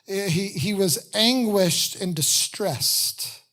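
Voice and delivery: anguished stretched slow, falling tune; slow emphatic repeat. Retold or imitated. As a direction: spell out anguished and distressed slowly.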